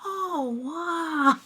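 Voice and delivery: lightly ape the meme's awed reaction; monkey meme wow. Retold or imitated. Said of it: "that monkey meme".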